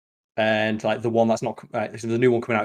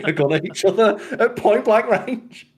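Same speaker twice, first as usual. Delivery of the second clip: excited; 9 semitones higher, 5 dB louder than usual. Real, not acted. Laughing at absurd fight.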